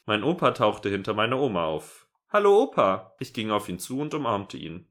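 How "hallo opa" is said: high greeting. Acted. Granddaughter greets grandpa, high.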